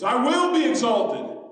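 high, loud, drawn-out declaration; booming proclamation. Acted. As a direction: proclaim it loudly as God. God's proclamation repeated loudly.

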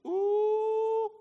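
high, loud, held ooh; shocked "ooh". Acted. Onlooker hearing Peter rebuke Jesus, loud high ooh. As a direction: let out a long crowd-style ooh.

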